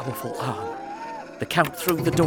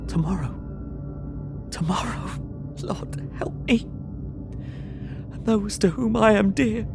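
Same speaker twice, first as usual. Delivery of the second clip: desperate prayer; 9 semitones higher, 3 dB quieter than usual. Acted. Harker praying in despair.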